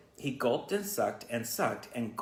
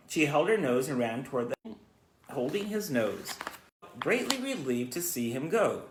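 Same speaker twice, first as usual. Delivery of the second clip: ordinary delivery, nothing marked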